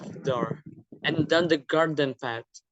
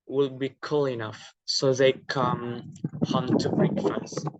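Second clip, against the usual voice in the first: ordinary delivery, nothing marked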